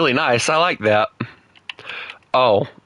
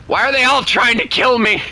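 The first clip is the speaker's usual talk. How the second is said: high, loud panicked wail; panicked whine; 10 semitones higher, 3 dB louder than usual. Real, not acted. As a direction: cry out in despair. Chased by enemies in game.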